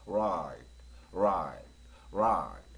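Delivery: right drawn out with a deep-set R; R with pulled-back jaw. Shown as a demonstration. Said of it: "턱을 당기면서".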